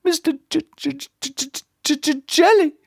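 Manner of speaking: high, nervous stutter; stammered. A narrator's reading. Mister Jelly telling the tramp his name, stammering. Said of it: "stammered Mister Jelly".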